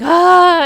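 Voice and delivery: loud, high drawn-out "ah", imitating a release; cathartic cry imitated. Describing her crying sessions, imitated cathartic wail. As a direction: mimic a cry from the depths. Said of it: "I am making sounds just like".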